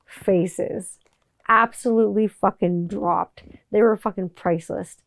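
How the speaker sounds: ordinary delivery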